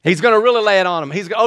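normal talking voice

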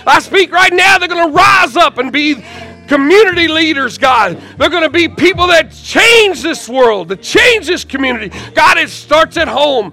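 high, loud preaching prayer; fervent prayer. Real, not acted. Pastor praying over those who came forward, fervent shouting. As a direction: cry out to God at full force.

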